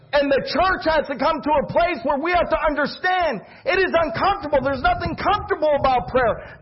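full-force sermon delivery; impassioned preaching. Acted. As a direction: build from firm appeal to high, driving exhortation.

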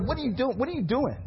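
high, imitated angry questioning; retold fury. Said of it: "he was furious".

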